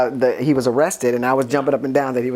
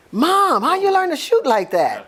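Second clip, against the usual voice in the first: much higher, awed question; amazed; 12 semitones higher than usual. Retold or imitated. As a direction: voice the son's amazement at her aim. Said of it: "Todd was like".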